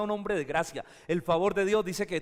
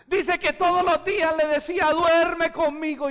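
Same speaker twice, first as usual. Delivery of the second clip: preaching loudly; 11 semitones higher, 8 dB louder than usual. Acted.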